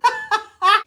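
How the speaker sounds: short high-pitched laugh; quick real giggle. Real, not acted. Own laugh while mixing.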